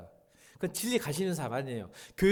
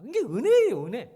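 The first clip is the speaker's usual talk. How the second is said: high, loud burst of joy; fervent exclamation; exclaiming grace; 11 semitones higher, 11 dB louder than usual. Real, not acted. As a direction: proclaim it with delight. Preaching at full force.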